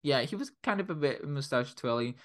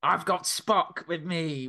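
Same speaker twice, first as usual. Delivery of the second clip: raised-pitch hammed-up villain boast; gloating villain; 6 semitones higher, 4 dB louder, slower than usual. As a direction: play along as a triumphant villain. Joins in mock villain boast.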